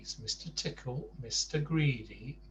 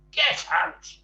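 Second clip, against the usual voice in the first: much higher, loud furious yell; shout; 14 semitones higher, 9 dB louder than usual. Acted. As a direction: blast him out of the room. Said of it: "shouted Mister Rude".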